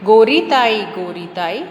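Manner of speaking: teacher reciting a nursery rhyme; children's rhyme. Acted. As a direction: chant the rhyme with a bouncing rhythm. Rhyme line repeated.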